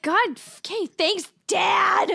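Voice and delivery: high, exasperated retort rising to a shout; mock exasperation. Retelling a sarcastic comeback to a pedantic friend, mock exasperated shout. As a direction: snap back, shouting the last word. Mock annoyance at being corrected.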